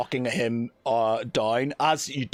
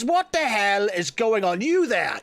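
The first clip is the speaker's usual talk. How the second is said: much higher, harried, blustering captain; irate captain; 10 semitones higher than usual. Acted. Captain scolding the lizard boy on a busy day, irate. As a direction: fume at the stowaways like a busy captain. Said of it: "It's like".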